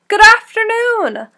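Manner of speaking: high, loud, smiling hello; cheery greeting. Acted. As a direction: greet everyone with a big smile. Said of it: "a great big smile and a".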